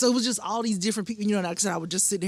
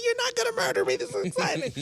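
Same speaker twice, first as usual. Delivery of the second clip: much higher thrilled squeal; squealing excitement. Retold or imitated. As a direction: gush like a starstruck fan. Relieved excited.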